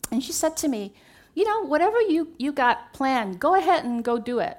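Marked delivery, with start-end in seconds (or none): 1.4-4.5 breezy insistence. Retold or imitated. Mother urging her to go.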